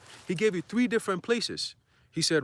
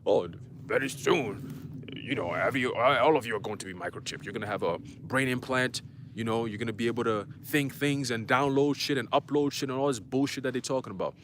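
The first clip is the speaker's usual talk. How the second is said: Klaus Schwab impression; 5 semitones lower than usual. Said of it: "Klaus Schwab has said".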